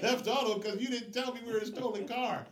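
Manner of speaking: high, loud, laughing panic; hammed-up frantic protest; mock panic. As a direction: wail it like a scared friend facing jail. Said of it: "And he's like".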